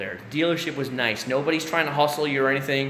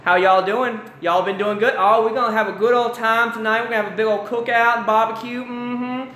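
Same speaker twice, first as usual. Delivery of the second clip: put-on high, louder Southern accent; Southern drawl imitation; 7 semitones higher, 4 dB louder than usual. North Florida folks greeting visitors, mock Southern accent. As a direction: greet like a cheerful Southern neighbor. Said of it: "people going".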